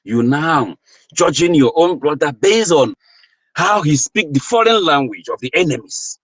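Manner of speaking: ordinary talk, nothing marked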